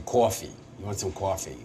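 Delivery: Long Island vowels, "coffee" as "caw-fee"; Long Island accent. Shown as a demonstration. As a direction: demonstrate a thick Long Island "cawfee". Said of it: "it's a Long Island".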